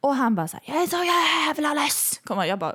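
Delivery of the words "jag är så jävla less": high, drawn-out balcony yell; loud, slow mock rant; shouting angry neighbour. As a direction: bellow it like a fed-up man. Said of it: "och skriker".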